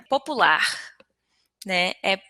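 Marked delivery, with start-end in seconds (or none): 0.1-0.6 "Popular" said po-pu-LAR, the Portuguese way; stress on last syllable. Shown as a demonstration. Teacher giving the host a Portuguese example, final-syllable stress on "Popular". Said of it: "a sílaba tônica é assim".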